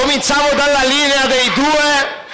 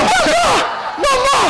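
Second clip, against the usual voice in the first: high shriek; slower than usual. Real, not acted. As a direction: squeal in alarm. Shrieking at near-hit shot. (strillo acuto di spavento)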